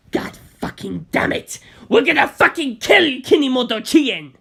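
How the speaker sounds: a gangster raging over a murdered friend; furious gangster. Acted. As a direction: scream it like he wants blood. Bucciolati voiced, raging.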